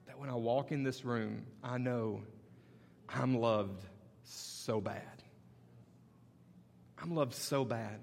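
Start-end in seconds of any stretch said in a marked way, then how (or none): 3.1-8.0 slow, soft and low; quiet heartfelt assurance; tender. Acted. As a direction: let it land gently.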